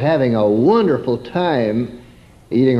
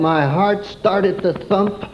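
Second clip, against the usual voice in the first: usual speaking voice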